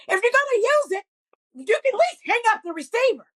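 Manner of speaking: high-pitched exasperated scolding, no extra volume; mock scolding scream. Mother telling off her kids about the phone, high mock scream. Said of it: "And I scream at the kids".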